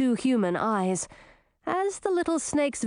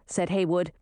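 usual speaking voice

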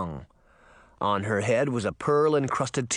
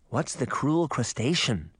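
usual speaking voice

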